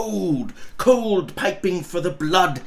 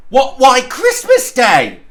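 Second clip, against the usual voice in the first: put-on boy, higher and much louder, amazed; boy calling back; 7 semitones higher, 9 dB louder than usual. A boy in Sunday clothes to Scrooge, amazed shout. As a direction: shout back as if it's obvious. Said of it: "the boy".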